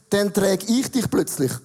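ordinary talk, nothing marked

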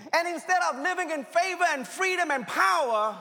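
high, loud preacher's cry building through the lines; rising, loud sermon delivery; full-force preaching. Acted. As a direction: preach it out loud to the whole room.

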